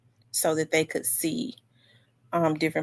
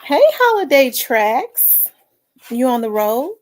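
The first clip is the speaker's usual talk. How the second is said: excited hello; 5 semitones higher, 7 dB louder than usual. Real, not acted. Greets viewer on stream.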